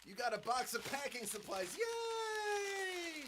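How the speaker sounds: high put-on excitement building to a squeal; hammed-up high-pitched delight; mock cheering. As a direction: cheer up into a long falsetto yay. Mock cheer over packing supplies.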